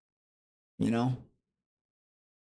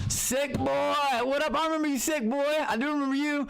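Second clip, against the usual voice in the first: much higher, bright hype greeting to a fan; excited shout-out; 14 semitones higher than usual. Real, not acted. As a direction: call out the viewer's name, pumped. Greeting a viewer in chat.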